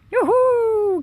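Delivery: very high, loud whoop; joyful yell. Acted. Children riding the dog sled, joyful loud whoop. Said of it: "crient les enfants".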